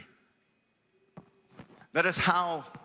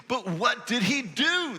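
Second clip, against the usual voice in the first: pressing Pharisee; 7 semitones higher, 3 dB louder than usual. Acted. Pharisees pressing the healed man.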